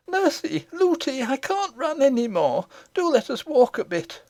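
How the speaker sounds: put-on high child's voice, pleading to stop; a small girl too tired to run; worn-out little princess. A narrator's reading. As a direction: princess begging her nurse to walk.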